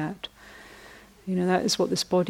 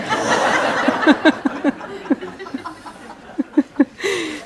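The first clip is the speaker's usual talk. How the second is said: wheezing laugh; 10 semitones higher, 5 dB louder than usual. Real, not acted. Laughing at punchline.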